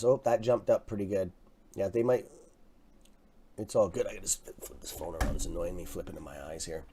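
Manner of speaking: ordinary talk, nothing marked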